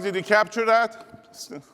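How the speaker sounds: ordinary delivery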